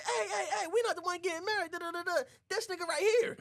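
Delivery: very high, hurried protest; mock protest. Boys tell the moms who's really getting married, high mock protest. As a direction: plead innocence in a high voice.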